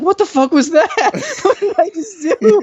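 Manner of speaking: high-pitched imitation of panic, breaking into laughter; mock panic. Friend panicking after staring the girl away, high mock panic. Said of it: "his expression turns to panic".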